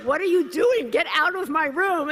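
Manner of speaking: very high, loud shout; high, loud outraged yell; furious yelling. Retold or imitated. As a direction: yell him out of the room. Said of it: "And I was like".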